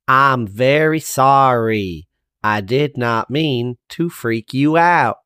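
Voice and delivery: very slow, spaced-out words; slow right down so he can follow; deliberately slow. Acted. Half Pint apologises to the slow-talking guard, very slowly.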